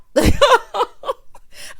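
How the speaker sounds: high, loud burst of laughter; laughing. Real, not acted. Host amused by split-shift joke, laughing. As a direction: laugh off your own threat.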